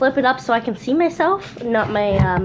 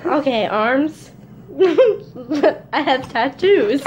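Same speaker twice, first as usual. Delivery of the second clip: usual speaking voice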